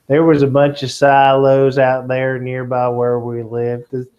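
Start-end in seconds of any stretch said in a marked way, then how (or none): none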